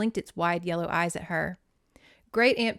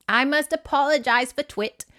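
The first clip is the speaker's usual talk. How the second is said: high, louder British great-aunt; bad British accent; 6 semitones higher, 5 dB louder than usual. Great aunt apologizing for the stuffed owl, bad British accent. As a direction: apologize primly in a hammed-up British voice.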